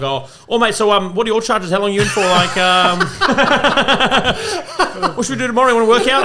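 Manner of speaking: high, loud, overeager chatter at a cellmate; pestering cellmate. Retold or imitated. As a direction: nag the cellmate like a hyper, wired inmate. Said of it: "going like".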